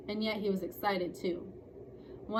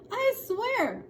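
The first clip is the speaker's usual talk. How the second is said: put-on high protesting boy; 14 semitones higher, 6 dB louder than usual. Quarrel protest.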